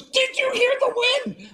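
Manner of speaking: excited woman's voice. Retold or imitated. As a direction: squeak it out like the thrilled singer. Brazilian singer voiced excitedly.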